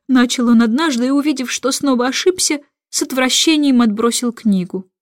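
plain reading voice

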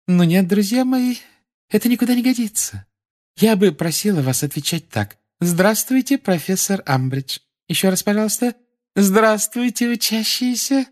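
high, saccharine schoolmistress; sugary Umbridge voice. A narrator's reading. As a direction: correct the children with false sweetness. Umbridge corrects class.

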